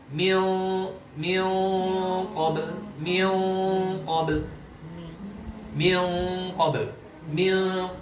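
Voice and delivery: "Mil" held long as a dark, half-hidden nasal N, then released into "qabl"; thick ikhfa. Shown as a demonstration. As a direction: hum "Mil" steadily with the tongue not yet touching, only then say "qabl". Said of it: "Persiapan dan tebal". ("Mil" dengung tebal samar, baru masuk "qabl")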